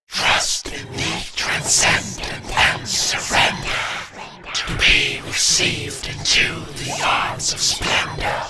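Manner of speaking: echoing, whispery chant in slow rhymed lines; voiceless, ghostly whisper drenched in reverb; eerie incantation. Acted. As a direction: recite like a spirit casting a spell. Otherworldly voice recites a rhymed incantation.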